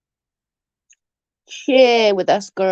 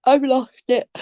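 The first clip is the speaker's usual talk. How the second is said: exasperated; 4 semitones higher than usual. Real, not acted. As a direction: still high, giving up on herself. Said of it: "there's no losing about it".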